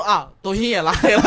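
laughing delivery; laughing punchline. Retold or imitated.

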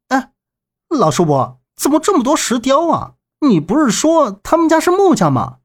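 puzzled question, pitch climbing high. A narrator's reading. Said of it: "周震疑惑地瞅着问道".

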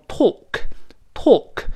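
British "talk" with a long rounded "aw"; British pronunciation. Shown as a demonstration. Teacher showing learners British English, rounded British vowel. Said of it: "英式发音读成".

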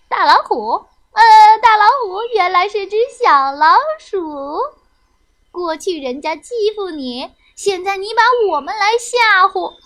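loud, taunting little animals; scornful, sing-out teasing; mocking. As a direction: jeer at the bully with new courage. Animals mock the exposed tiger.

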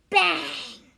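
explosive story sound effect; sudden loud bang; loud crash word. A narrator's reading. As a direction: punch it out like a collision.

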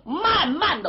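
ordinary delivery, nothing marked

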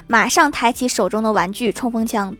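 ordinary delivery

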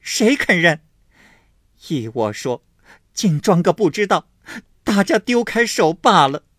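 high, loud, bitter sarcasm; cold sneer. A narrator's reading. Wife sneering at the family, high and bitter. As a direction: scoff bitterly, playing the victim. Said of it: "金贵冷笑道".

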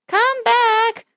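high calling shout. Acted. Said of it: "cried the little old man".

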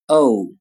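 o gliding from o to u; teacher modelling o; diphthong o. Shown as a demonstration. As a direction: glide the o into u. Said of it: "发作".

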